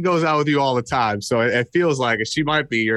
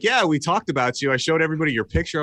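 fast casual brag; 2 semitones higher, faster than usual. Retold or imitated. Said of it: "I'm like".

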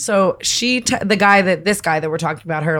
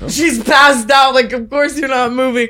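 exasperated shout; 5 semitones higher, slower than usual. Real, not acted. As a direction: yell it in disbelief.

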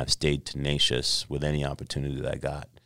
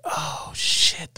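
high, breathy whispered oh shit; stunned gasp; 12 semitones higher than usual. Retold or imitated. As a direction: react stunned, in a high airy hush. Retold stunned reaction to dad.

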